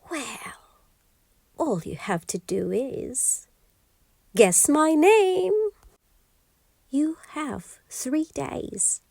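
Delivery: a triumphant little imp refusing; gleeful imp. Acted. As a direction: imp gloating over the queen's offer. Imp sets the guessing game.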